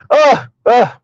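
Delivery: real high laugh at own joke; laugh, then catch yourself with little sighs; amused burst of laughter; cracking self up. Real, not acted. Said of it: "I cracked myself up".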